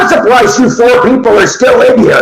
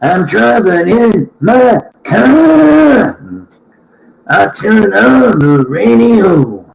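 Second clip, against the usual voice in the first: slow storytelling; much slower than usual. Pretend. Mock song setup.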